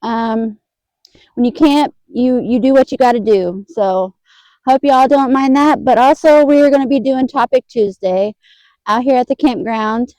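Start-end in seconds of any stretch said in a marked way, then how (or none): none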